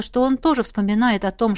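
usual speaking voice

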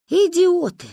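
high, loud, fond exasperation; exasperated exclamation. Real, not acted. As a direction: throw up your hands. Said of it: "grandma exclaims, exasperated".

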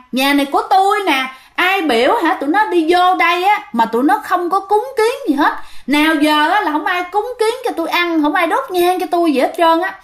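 high, aggrieved ghost voice; spirit's complaint. Retold or imitated. Spirit in mother's body complains to neighbor, high aggrieved whine. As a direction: whine like a neglected old owner of the house. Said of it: "trả lời nhẹ nhàng hơn".

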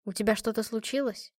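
ordinary delivery

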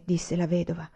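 ordinary delivery, nothing marked

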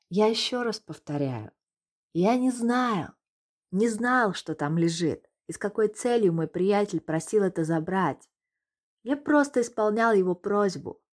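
exasperated insisting. Acted. Irritated suspect insists.

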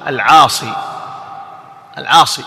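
"العاصي" ending in long ee; classical pronunciation. Shown as a demonstration. Teacher quoting the preferred form, "العاصي" with long final ee. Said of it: "الأفصح في اللغة".

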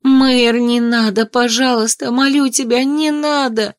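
high-pitched, anguished begging; desperate pleading. Acted. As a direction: beg him not to throw you out. Begging not to be thrown out.

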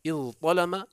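اضطلم with separate ظ then heavy ط; unmerged variant. Shown as a demonstration. Teacher lists three variants, اضطلم with ظ and ط apart. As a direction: say اضطلم as iẓ-ṭalama, both consonants heard. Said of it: "ففيها ثلاث لغات".

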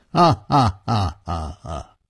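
low, measured ha, ha, ha; gang leader's laugh. Acted. Nangong laughs at his own jest, low deliberate laugh. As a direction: laugh in separate, lordly bursts. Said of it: "南宫绫抱拳笑道".

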